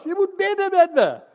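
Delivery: high, loud urgent plea. Retold or imitated. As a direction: insist loudly that he come inside. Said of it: "zei Jos". (luid dringend verzoek van de schilder)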